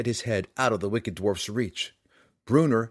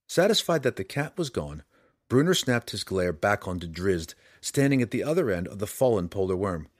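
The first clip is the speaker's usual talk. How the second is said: ordinary delivery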